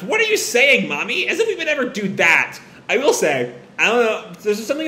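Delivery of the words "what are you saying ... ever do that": high, indignant protest; snapping. Acted. Erika snaps at her mother's question, high indignant voice. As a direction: protest sharply with the pitch leaping up. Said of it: "Erika snaps".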